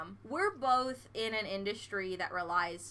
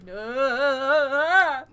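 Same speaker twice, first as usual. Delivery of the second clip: long, loud wavering cry of refusal; mock anguished wail; 3 semitones higher, 8 dB louder than usual. Reacting to a sound that shouldn't be loud, mock wail. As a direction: wail it out, drawn long and louder.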